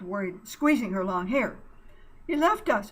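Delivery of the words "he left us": high loud distressed cry. Acted. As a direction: cry it out in despair. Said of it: "Buddy cried".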